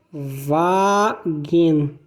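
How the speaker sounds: "Wagen" with a hard g, VAH-gen; German Wagen. Shown as a demonstration. Teacher modelling the German word for car. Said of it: "Um exemplo é a palavra Wagen".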